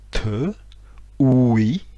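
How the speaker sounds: TE, OUI with a slightly questioning rise; interrogative tone. Shown as a demonstration. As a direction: let "te" and "oui" each curl upward. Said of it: "avec un accent interrogatif, euh, légèrement, euh, légèrement montant".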